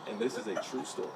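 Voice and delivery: ordinary talk, nothing marked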